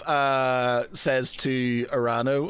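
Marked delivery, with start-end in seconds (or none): none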